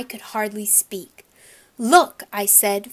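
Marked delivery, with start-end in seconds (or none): 1.8-2.2 loud, raised, upset cry; lump in throat. A narrator's reading. Boy shows mother the ruined poster, choked-up outcry. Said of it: "feeling a lump in my throat".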